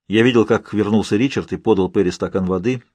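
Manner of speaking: ordinary delivery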